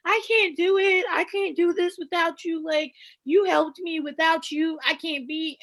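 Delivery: high, drawn-out imitation of helpless pleading; mock pleading. Recapping how she leaned on him, high mock plea. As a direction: imitate her pleading, pitched well up and stretched.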